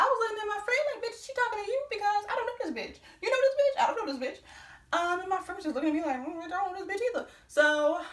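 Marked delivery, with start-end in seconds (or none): 1.0-5.0 high, incredulous mock protest; bewildered. Customer asking a friend about a stranger, bewildered. 6.3-7.3 bewildered denial. Retold or imitated. Friend's retold bewildered reply.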